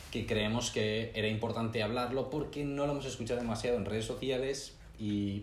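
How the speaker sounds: ordinary talk, nothing marked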